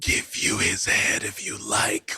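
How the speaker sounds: eerie whispered voice of Death; whispering Death. Acted. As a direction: breathe it out like a dread reaper.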